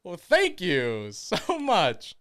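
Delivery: loud, high, drawn-out mock thanks; exaggerated gratitude. Driver answering coworker who forgot him, loud mock gratitude. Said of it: "And I go".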